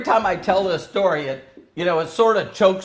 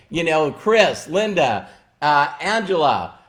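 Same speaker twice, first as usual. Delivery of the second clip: ordinary talk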